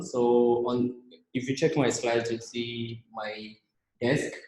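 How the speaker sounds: ordinary talk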